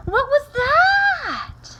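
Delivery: very high, loud, drawn-out cry; astonished shout. Acted. Kitten glimpsing himself in the mirror, astonished. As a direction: shriek it in amazement, stretching it out. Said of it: "exclaimed Skippy John Jones".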